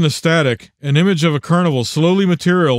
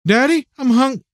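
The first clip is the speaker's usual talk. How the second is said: put-on high little-girl voice; young daughter; 10 semitones higher than usual. A narrator's reading. Daughter coming in hungry to her father, high child voice cut short. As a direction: pitch it up, breaking off mid-word. Small daughter interrupted mid-word.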